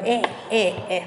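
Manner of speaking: usual speaking voice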